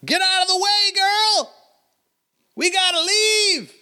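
put-on very high falsetto woman urging the girl away; shrill-free high pitched hurry-up call; carriage woman calling. Woman on carriage urging girl to leave, high falsetto.

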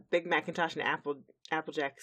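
ordinary talk, nothing marked

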